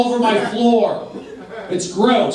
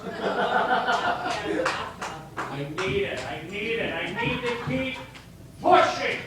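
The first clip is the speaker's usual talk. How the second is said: much softer, murmured to himself; hushed self-pep talk; 3 semitones higher, 7 dB quieter than usual. Acted. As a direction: talk yourself up under your breath.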